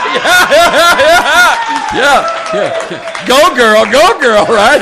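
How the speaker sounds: high, loud laughing cheer; excited cheer. Real, not acted. Cheering the Zena take.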